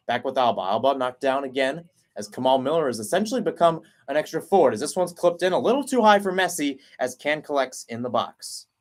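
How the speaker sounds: ordinary talk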